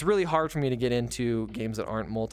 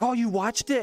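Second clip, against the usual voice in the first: high, bright surprise, much higher; surprised he actually watched it; 11 semitones higher, 3 dB louder than usual. Retold or imitated. To coworker, surprised he watched the show.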